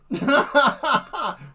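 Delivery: high cackling laugh with a cry in it. Real, not acted. Cracking up over his own robot doom joke, high laugh.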